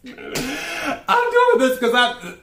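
high, slow, dissolving into laughter; laughing. Real, not acted. Reader trying to keep a straight face, breaking up laughing. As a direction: straight face fails, you lose it. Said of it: "I gotta keep a straight face".

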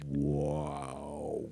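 low, growling belly rumble; deep guttural noise. Pretend. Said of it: "sounds like my stomach when I'm hungry".

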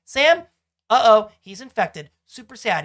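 high, loud, sing-song mock greeting; breezy then flat mock alarm; hammed-up retelling; mock cheerful Ellie. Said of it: "She's like".

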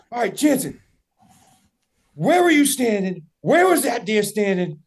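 imitating a buddy itching to find the deer; eager friend. Buddy asking Jansen where he stood, keyed up.

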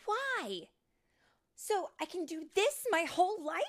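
high, loud protest; indignant interruption. Acted. Tink interrupting the older fairies, indignant. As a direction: cut in, outraged. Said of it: "Tink interrupted".

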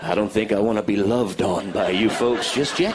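low, wary deadpan voice; flat low uneasy response; deadpan reply. Retold or imitated. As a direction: back away politely, uneasy. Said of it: "I'm going".

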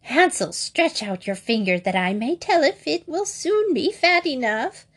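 high witch voice calling out. A narrator's reading. Witch calls Hansel to stretch out his finger, high and calling. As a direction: call into the stable as the witch. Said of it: "visited the stable and cried".

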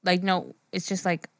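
normal talking voice

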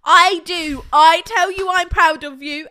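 loud, emphatic protest; exasperated insistence. Real, not acted. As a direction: hammer it home, fed up. Exasperated answer to his complaint.

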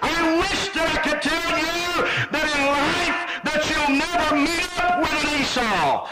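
driving, sustained pulpit cry; full-force preaching. Acted. Preacher warning the congregation life must be met, full-force preaching. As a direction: press each phrase out with fervor.

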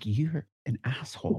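hushed quiet insult; soft mock whisper. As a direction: hiss the insult under breath. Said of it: "they're whispering".

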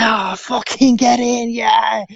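high, drawn-out cheer for a goal; shouted goal celebration. Retold or imitated. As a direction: re-enact shouting at the telly as the goal goes in. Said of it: "really shouting out loud".

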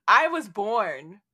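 slow boast. Retold or imitated. Goblin boasting in retold scene.

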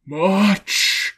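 high, loud ghostly answer; Marley's ghost. A narrator's reading. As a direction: answer as an eerie spectre. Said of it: "Marley's voice, no doubt about it".

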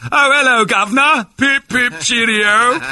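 put-on high, loud caricature English accent; hammed-up cartoon Englishman; mock British accent. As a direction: squeal it in a silly British accent. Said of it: "never do to you? Your accent".

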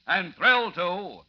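loud, high booming promo; old radio announcer. Acted. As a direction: hype the next episode. Closing announcement.

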